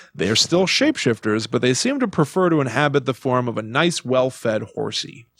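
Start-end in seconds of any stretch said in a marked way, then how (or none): none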